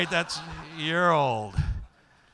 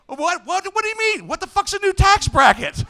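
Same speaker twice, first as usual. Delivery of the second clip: higher, louder, baffled outburst; mock outraged surprise; 11 semitones higher, 6 dB louder than usual. Comedian acting shocked at his new tax bracket. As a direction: sputter in disbelief at the news.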